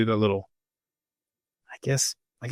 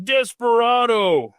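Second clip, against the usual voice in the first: mock shout; 10 semitones higher, 3 dB louder, slower than usual. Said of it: "people were like".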